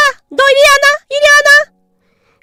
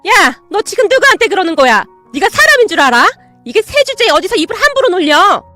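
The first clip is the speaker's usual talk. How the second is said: angry yelling aunt; 6 semitones higher, 6 dB louder than usual. Acted. Aunt yells at parrot.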